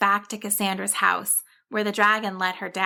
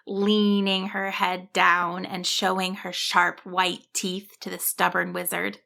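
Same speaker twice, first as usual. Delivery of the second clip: usual speaking voice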